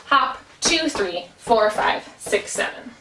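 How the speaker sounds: even beats pressed, two and four leaned on; syncopated count. Shown as a demonstration. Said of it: "this syncopation where the downbeat or the even beat is getting a little bit more emphasis".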